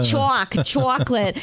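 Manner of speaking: imitated New Jersey "chocolate", with a w-glide after the ch; New Jersey accent. Host echoes toddler's viral chocolate video, imitated New Jersey accent. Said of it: "in a strong New Jersey accent".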